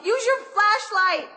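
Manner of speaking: slow, loud call to someone outside. Acted. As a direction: call out slowly so he hears. Trapped, calling to the guard.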